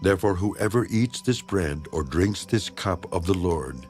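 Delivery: ordinary talk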